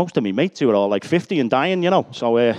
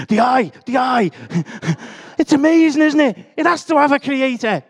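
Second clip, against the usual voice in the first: much higher and louder, gushing with wonder; excited impression of Phil; 12 semitones higher, 5 dB louder than usual. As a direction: ham up an amazed enthusiast raving about the eye. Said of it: "he keeps going on about the human eye".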